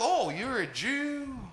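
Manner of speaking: high, slow Southern drawl; drawn-out Texan question; dim Texan sergeant. Retold or imitated. Sergeant guessing who he is, slow Southern drawl. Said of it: "He's like".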